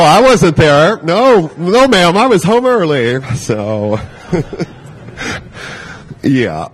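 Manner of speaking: ordinary talk, nothing marked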